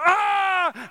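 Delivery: drawn-out high yell of effort; mock yell while chucking a bottle; acted angry yell. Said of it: "when you're angry".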